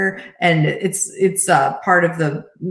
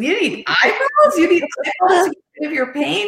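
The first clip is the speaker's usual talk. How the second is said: much higher, playful disbelief; mock amazement; 11 semitones higher than usual. Mother playing along with son's eyeball joke, mock astonished. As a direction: ham up the shock to make him laugh.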